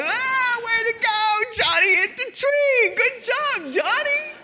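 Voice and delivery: very high, loud mock cheering; kids cheering. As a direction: whoop like kids on the playground. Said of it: "we're like".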